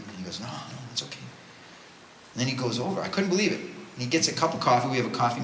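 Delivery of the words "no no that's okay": soft, hushed reassurance; gentle refusal. Retold or imitated. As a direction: wave it off very quietly. Gracious man waving off an apology.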